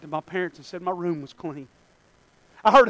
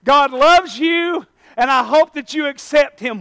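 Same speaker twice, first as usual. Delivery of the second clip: slow, tender, earnest assurance; gentle encouragement; 6 semitones higher, 7 dB louder, slower than usual. Retold or imitated. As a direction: lean in and say it kindly. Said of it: "he would say".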